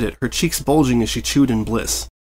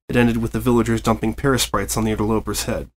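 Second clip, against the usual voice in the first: usual speaking voice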